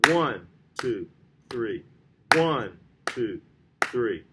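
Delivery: One hit a little harder, two and three lighter; stress on One. Shown as a demonstration. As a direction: count evenly, leaning on each One. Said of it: "put a little more emphasis on that note".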